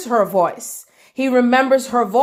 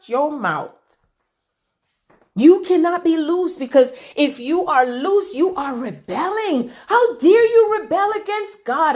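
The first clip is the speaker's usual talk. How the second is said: mock accuser; 6 semitones higher than usual. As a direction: play the accusers, lash out at her. Voicing accusers shaming a woman.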